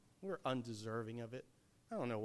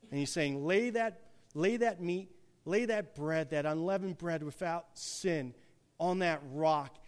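louder, higher preaching build, reading the verse out; full-force preaching; 5 semitones higher, 11 dB louder than usual. Acted. Preacher to his congregation, rising to full force. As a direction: pour it on like a revival preacher.

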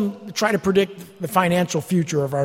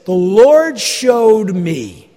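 slow, loud drawn-out proclamation; pompous, slow "The Lord showed me"; mock pious claim; 6 dB louder, much slower than usual. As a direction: stretch it out like a self-important prophet. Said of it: "they say".

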